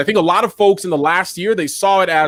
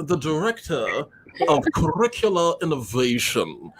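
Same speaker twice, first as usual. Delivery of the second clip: a lightly hammed-up self-important announcement, softer; mock grand title voice; 6 dB quieter than usual. Shown as a demonstration. Host teasing the guest's fancy job title, mock grand voice. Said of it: "needs to be said with an accent or with, like".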